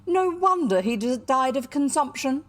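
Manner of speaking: loud, high rebuke; scornful retort. A narrator's reading. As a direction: throw it back as a forceful scolding. Scandalized aunt retorts to child.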